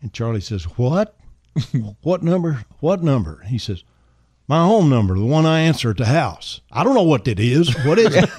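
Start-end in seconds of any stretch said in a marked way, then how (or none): 0.8-3.4 baffled, high-pitched questions. Retold or imitated. Charlie baffled by Elvis's order. 4.5-6.6 raised, insistent voice. Retold or imitated. Elvis repeats order to baffled aide. 6.7-8.3 loud, high, flustered protest; hammed-up bafflement of a cornered aide. Charlie panics over the number.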